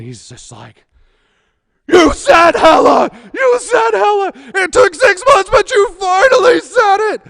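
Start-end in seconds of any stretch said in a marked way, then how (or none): 1.9-7.2 high, loud gleeful yell; triumphant shout. Retold or imitated. Boss catching him out in the ops center, high gleeful yell. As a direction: scream it in triumph, voice cracking high.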